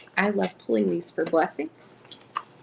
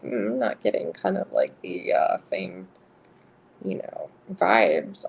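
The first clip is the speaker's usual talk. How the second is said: imitating the guy; 9 semitones lower than usual. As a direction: drop deep and drag it like a doubtful dude. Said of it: "if he's, like".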